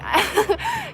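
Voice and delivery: high burst of laughter; self-mocking laugh. Real, not acted. Seeing her mother in herself, high laugh. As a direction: laugh at your own expense.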